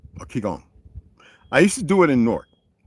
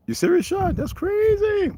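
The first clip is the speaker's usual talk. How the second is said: surprised. Real, not acted. Reacting to someone's story.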